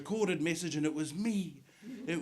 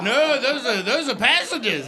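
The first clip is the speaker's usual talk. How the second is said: mock correction; 8 semitones higher, 9 dB louder than usual.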